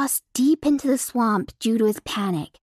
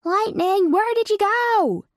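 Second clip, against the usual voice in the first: yelling a name; 10 semitones higher than usual. Acted. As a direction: yell out searching for him. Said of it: "Familiar voices yelled his name".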